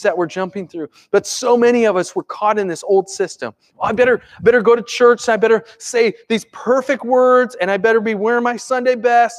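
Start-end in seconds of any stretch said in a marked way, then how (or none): 3.8-9.4 lightly acted fretful churchgoer, higher pitch; mock-worried, piling up the rules; a touch of self-pressuring worry; anxious churchgoer. Voicing anxious rule-bound churchgoer.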